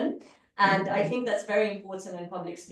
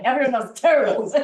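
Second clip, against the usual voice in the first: laughing joke; 2 semitones higher, 8 dB louder, faster than usual. Real, not acted. Joking aside after Ah counter report.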